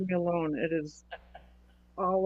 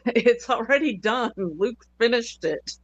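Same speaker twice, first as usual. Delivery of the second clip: ordinary talk, nothing marked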